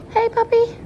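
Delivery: high, loud puppy greeting; pet talk. Real, not acted. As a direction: coo at the puppy, bright and loud. Greeting dogs at breakfast.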